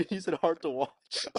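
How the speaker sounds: high, loud words through laughter; real helpless laughter on the words; laughing. Real, not acted. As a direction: crack up while saying it. Laughing at own mishearing.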